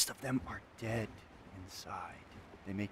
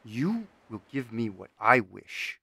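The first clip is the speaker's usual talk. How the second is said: loud, insistent commanding no's; furious refusal; 14 dB louder than usual. Acted. Artisan commanding the bound spirit, loud and furious. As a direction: slam down the demand.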